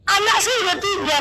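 acted-out hurried griping; complaining friend. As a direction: imitate a friend reeling off woes. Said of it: "banyak kali nih keluhannya".

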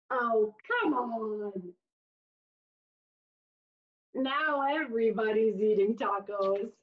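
usual speaking voice

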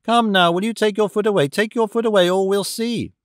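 put-on a small boy trying to sound menacing; a child losing patience with the man; irritated, menacing child. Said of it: "irritated, becoming almost menacing".

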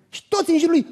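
usual speaking voice

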